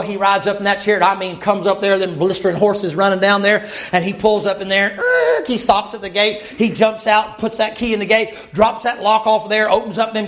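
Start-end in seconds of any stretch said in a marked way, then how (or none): 4.9-5.5 high squealing brake noise; braking screech. Retold or imitated. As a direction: screech the chariot to a halt. Chariot pulls up at gate.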